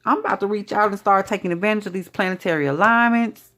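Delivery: high-pitched, acted-out refusal; telling them off. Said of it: "You gotta tell them".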